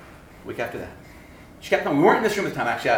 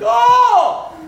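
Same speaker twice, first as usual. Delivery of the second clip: high, loud drawn-out "Oh!"; cry of being beaten; 9 dB louder than usual. Retold or imitated. Preacher miming a fight beating, loud yell. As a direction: yelp like taking a punch.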